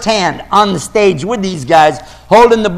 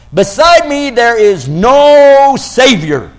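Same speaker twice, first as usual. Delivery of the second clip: slow, louder, weighty reading; emphatic verse; 4 semitones higher, 4 dB louder, slower than usual. Acted.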